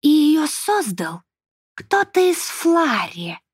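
slow, high, otherworldly elf voice; a drawn-out voice of a fey being; Sarvan's voice. Acted. Sarvan speaks, narrator voices him.

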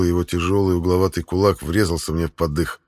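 ordinary delivery

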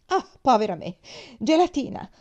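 high, loud exasperated outburst; irritated. A narrator's reading. Sick woman reacting to the dish brought, irritated. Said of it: "disse una voce irritata".

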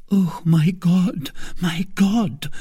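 slow, trembling dread; frightened murmur. A narrator's reading. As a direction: breathe it in fear. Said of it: "murmured the queen ... chattered with fright".

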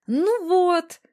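high, loud, eager exclamation; enthusiastic. A narrator's reading. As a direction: chime in brightly, pitch jumping up. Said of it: "воодушевлённо подхватила девушка". (воодушевлённо, высоко и звонко)

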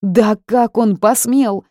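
furious shout. A narrator's reading. Heroine rages about the mage, slow furious shout. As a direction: shout with clenched fists. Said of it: "И закричала, сжимая кулаки".

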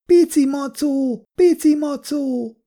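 put-on very high, drawn-out child's call; small child shouting. A narrator's reading. Small child calls for the teddy-bear juice, high drawn-out shout. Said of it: "azonnal kiabált".

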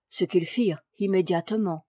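plain reading voice

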